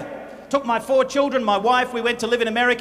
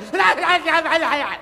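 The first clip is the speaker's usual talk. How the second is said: hearty laugh; 6 semitones higher than usual. Real, not acted. Preacher laughing at own act.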